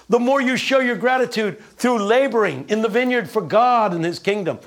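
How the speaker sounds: high, rousing pulpit cadence; preaching. Acted. Preacher driving home the prosperity gospel, rousing. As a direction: preach it up to the rafters.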